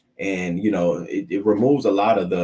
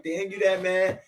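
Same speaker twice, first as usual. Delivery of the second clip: mock disbelief; 10 semitones higher than usual. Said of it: "Yes, bitch, I was mad".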